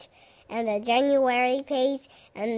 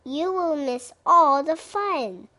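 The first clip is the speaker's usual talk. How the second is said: louder higher plea; raised pleading voice; cried out; 5 semitones higher, 5 dB louder than usual. A narrator's reading. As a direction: urge him not to miss out. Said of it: "cried Frog".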